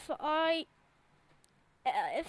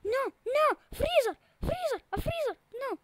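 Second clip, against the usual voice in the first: much higher and louder frantic yelling; panicked shout; 7 semitones higher, 8 dB louder than usual. Shown as a demonstration. Gamer shows how to freak out at the freezer, yelling in panic. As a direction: shriek like the freezer just caught you. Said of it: "Say it like this".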